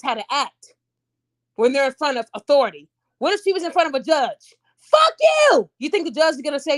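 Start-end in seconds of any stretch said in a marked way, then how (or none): 4.8-5.7 high, loud defiant yell; angry shout. Acted. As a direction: yell it at the judge like a bratty child. Imagined child cursing at a judge.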